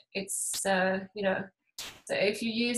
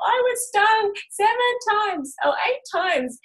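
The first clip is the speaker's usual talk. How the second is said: put-on bragging little girl; 10 semitones higher than usual. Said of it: "who she was all".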